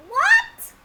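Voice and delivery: very high, loud squawk; exaggerated shock. Storyteller breaking off the sign-off, exaggerated shocked shriek. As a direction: squawk it in mock disbelief.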